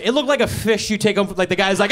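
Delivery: usual speaking voice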